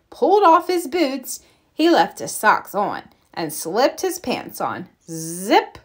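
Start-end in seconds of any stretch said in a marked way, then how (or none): none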